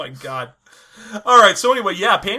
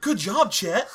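put-on Hardy Boys sleuth; 3 semitones higher, much slower than usual. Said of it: "That was like a Hardy Boys thing".

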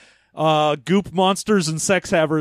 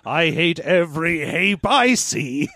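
slow, hammed-up parody line; mock theatrical quote; slower than usual. Joking about Hape law, slow mock-theatrical delivery. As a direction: draw it out as a theatrical spoof.

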